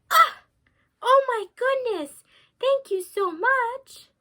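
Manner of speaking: high, loud overjoyed thanks; relieved joy. Acted. Grandmother thanking the woodsman, high loud relieved joy. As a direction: burst out with relief.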